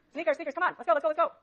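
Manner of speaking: loud, high call to the back rows; rally the class to come up. Real, not acted. Teacher calling students up front, loud and eager.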